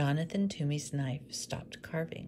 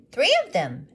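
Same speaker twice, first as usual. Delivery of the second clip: much higher and much louder; boy adding the wise men; eager little boy; 9 semitones higher, 13 dB louder than usual. Acted. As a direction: pipe up proudly. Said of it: "added Thomas".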